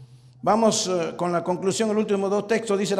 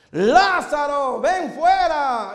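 slow, drawn-out call; 2 semitones higher, much slower than usual. Acted. Jesus calls Lazarus out, slow and steady. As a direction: call "ven fuera" long and measured. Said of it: "volvió a su cuerpo".

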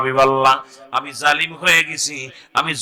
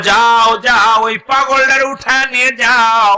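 raised, high insistent order; loud urging; 8 semitones higher, 5 dB louder than usual. Acted. Villager telling visitors to take the crying man away, loud urging.

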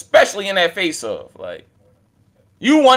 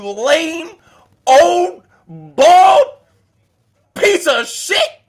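rising yell; 7 semitones higher, 4 dB louder, much slower than usual. Acted. Insulting Danny, building up.

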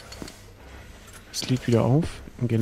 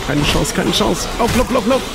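high, urgent running panic; being chased; 6 semitones higher, 3 dB louder than usual. Real, not acted. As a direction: urge yourself to run. Fleeing enemies in game.

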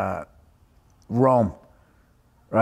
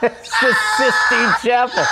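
slow, raised-pitch mock correction; imitated exasperated wife; 4 semitones higher, slower than usual. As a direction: spell it out slowly in mock exasperation. Retold exasperated wife correcting him.

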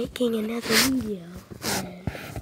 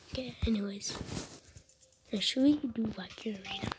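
whispering; 6 dB quieter, faster than usual. Real, not acted. Whispered gameplay.

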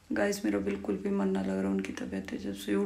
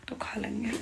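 whisper; 8 dB quieter than usual. Real, not acted. Night, patient sleeping nearby.

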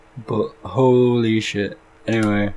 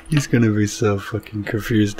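ordinary delivery

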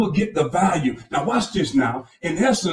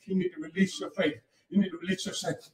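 much softer, intimate repeat; quiet repeat; 9 dB quieter, faster than usual. Acted. Pastor pressing the point gently to the congregation, soft repeat. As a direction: drop it down, almost confiding.

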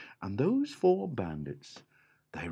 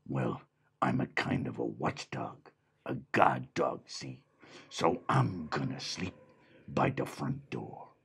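lower, gruff watchdog voice; gruff dog; 7 semitones lower than usual. A narrator's reading. Dog claiming the front door, in a low gruff voice. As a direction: speak like a tough guard dog. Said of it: "And the dog said".

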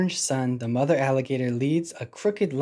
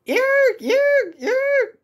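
tiny squeaky yelps; baby animal cries; 6 dB louder, much slower than usual. Acted. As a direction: yelp like baby creatures. Baby alligators crying.

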